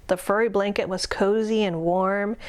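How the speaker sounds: ordinary delivery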